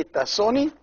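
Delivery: "soni" with a short, brief O; don't stretch the O in "soni"; the mistaken short-O "soni". Shown as a demonstration. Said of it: "with a short O".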